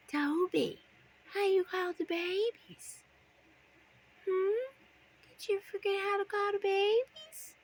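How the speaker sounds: high playful pet talk. Real, not acted. Coaxing bird to call.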